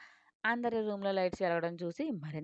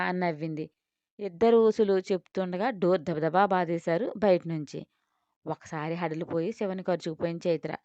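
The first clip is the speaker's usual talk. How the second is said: plain reading voice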